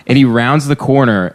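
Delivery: usual speaking voice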